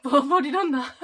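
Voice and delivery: laughing at herself. Real, not acted. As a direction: giggle through the words. Laughing at her own slip.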